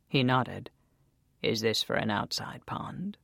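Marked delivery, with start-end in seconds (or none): 1.4-3.2 slow creepy whisper; whispering shopkeeper. Acted. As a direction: breathe it out slowly and slyly. Shopkeeper speaks softly.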